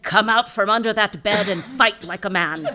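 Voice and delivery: loud, laughing mock-angry wife; punchline shouted through laughter; wife's order. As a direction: bark it like a fed-up Irish wife. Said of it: "She said".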